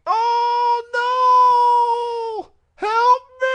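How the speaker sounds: high, loud panicked wail; shrieking falsetto plea for rescue; Paul Bearer impression. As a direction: cry out like an emergency call for help. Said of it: "like a nine one one call".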